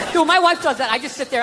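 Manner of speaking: normal talking voice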